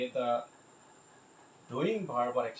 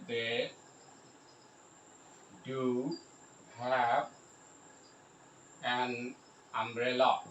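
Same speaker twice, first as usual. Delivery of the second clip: slow dictation, each word apart; slower than usual. Shown as a demonstration. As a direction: leave a long pause after each word. Spelling out example while writing.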